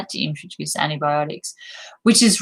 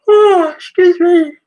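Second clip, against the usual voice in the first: words stretched through a big high yawn; 13 semitones higher, 10 dB louder, slower than usual. Real, not acted. Vlogger yawning mid-video, apologizing through a yawn. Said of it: "here I am yawning".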